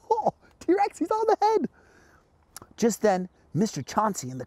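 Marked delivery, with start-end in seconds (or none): 0.1-1.7 very high, excited squeal of words; mock alarm. Host telling toy T-Rex about George's climb, high mock alarm. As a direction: pretend to be amazed, pitched way up.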